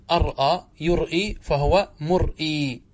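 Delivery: slow أرأى يرئي, a catch before each vowel; original hamza forms. Shown as a demonstration. Teacher shows the underlying form slowly, hamza sounded. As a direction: break مرئي as mur-ee with hamza. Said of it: "هكذا أصله".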